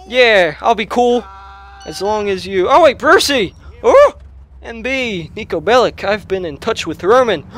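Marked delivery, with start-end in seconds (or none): 2.7-4.1 sudden high yelp of surprise; surprised. Real, not acted. Phone rings with Brucey calling, surprised. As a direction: jump up in pitch, caught off guard.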